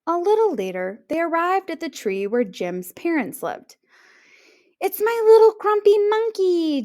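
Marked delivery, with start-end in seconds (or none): 4.8-6.8 high, loud doting cry; delighted cry. A narrator's reading. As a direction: greet him with joy. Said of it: "Jim's mom cried".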